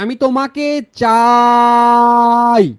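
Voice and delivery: চাই drawn right out on a level note; চাই hugely stretched. Shown as a demonstration. Teacher demonstrating a stretchable vowel to students, চাই hugely stretched. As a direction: hold the আ in চাই very long. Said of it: "অনেক বড় করতে পারি".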